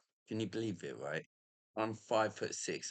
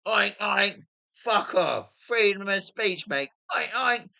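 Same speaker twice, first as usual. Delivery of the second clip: loud, high-pitched taunting yell; belted-out jeer, pitched way up; defiant heckling shout; 12 semitones higher, 14 dB louder than usual. Retold or imitated. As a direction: shout pig noises and abuse at passing police. Acting out yelling at passing police.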